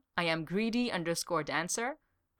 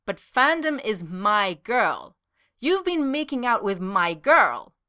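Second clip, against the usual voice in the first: loud, high and slow, wounded and indignant; a jealous office mate accuses his rival, loud and hurt; jealous outrage; 3 semitones higher, 6 dB louder, slower than usual. Acted. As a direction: drag out each word, outraged. Jealous rival confronts coworker.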